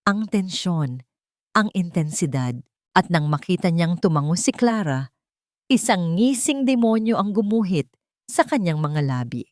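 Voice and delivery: ordinary delivery, nothing marked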